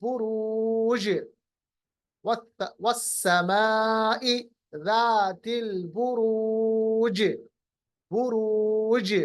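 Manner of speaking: बुरूज stretched slow with echoed ज; qalqalah recitation. Shown as a demonstration. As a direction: draw out the ऊ, bounce the ज of बुरूज. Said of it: "जीम को वापस लाइए इसकी आवाज को". (खींचकर बुरूज, जीम का क़लक़ला)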